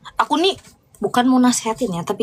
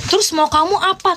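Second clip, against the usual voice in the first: imitating him; 7 semitones higher than usual. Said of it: "dia bilang kayak gini".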